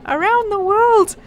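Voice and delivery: an eager lad asking; boy voice. Acted. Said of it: "The boy laughed wildly".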